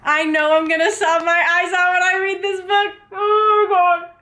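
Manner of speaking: overexcited squeal; giddy excitement. Real, not acted. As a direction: gush it out. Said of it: "I do not belong on the streets".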